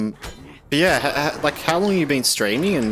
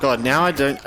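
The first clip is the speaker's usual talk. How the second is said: usual speaking voice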